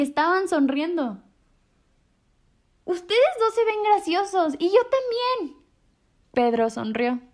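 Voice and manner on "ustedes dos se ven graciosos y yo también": put-on high, light child's voice; a happy little friend joking along; cheerful kid voice. A narrator's reading. Kind friend's line in story.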